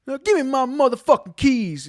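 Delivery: high, loud furious demand; entitled star yelling at the valet. Retold or imitated. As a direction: bark for the keys. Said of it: "He's like".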